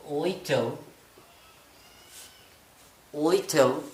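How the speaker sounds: ओइटल with dropped t and dark l; teacher models ओइटल, glottal t and dark l. Shown as a demonstration. Teacher shows students ओइटल instead of little. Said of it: "उसके बजाय आप पढ़ें ओइटल".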